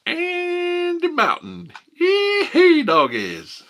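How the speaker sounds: very high, slow, hammed-up Appalachian Andy Mountain; hillbilly accent. Streamer imitating a West Virginia caller, high twangy hollering. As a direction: stretch out Andy Mountain in a yodelling country twang. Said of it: "they actually talk like that".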